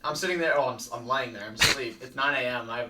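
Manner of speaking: usual speaking voice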